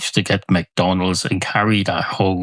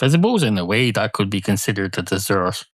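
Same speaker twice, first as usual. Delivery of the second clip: usual speaking voice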